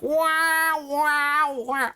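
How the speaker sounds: high, loud cartoon bird; cartoon stork voice. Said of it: "my immediate thought was from the song Kiss the Girl".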